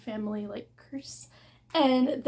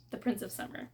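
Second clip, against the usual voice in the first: ordinary delivery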